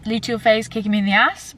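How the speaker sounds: usual speaking voice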